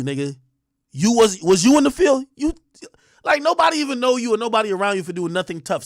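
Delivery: ordinary talk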